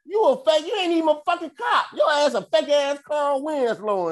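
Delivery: high, loud, fast yell; heated shouting taunt; angry yell. Retold or imitated. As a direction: rip into the fake cop. Yelling at fake cop.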